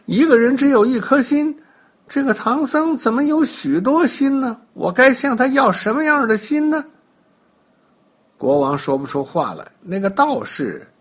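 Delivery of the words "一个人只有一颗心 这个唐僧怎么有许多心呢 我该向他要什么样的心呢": high, puzzled wondering; a confused king thinking aloud, pitched higher; baffled king. A narrator's reading. 国王心里犯糊涂. (国王一头雾水，高声犯嘀咕)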